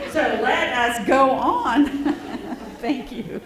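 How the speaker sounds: real laughter breaking in after a failed hymn; laughing through words. Real, not acted. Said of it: "I heard a lot of laughter".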